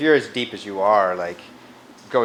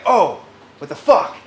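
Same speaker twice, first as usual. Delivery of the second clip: louder, higher amazed gasp of a curse; awed; 6 semitones higher, 8 dB louder than usual. Retold or imitated. Teacher imitating people struck by Skanda, louder and awed. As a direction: let it burst out in wonder. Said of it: "it makes you say".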